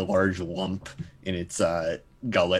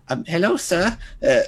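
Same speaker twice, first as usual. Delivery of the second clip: put-on high, polite little orphan boy; timid street urchin greeting; British orphan voice; 9 semitones higher, 4 dB louder than usual. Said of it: "British orphan voice".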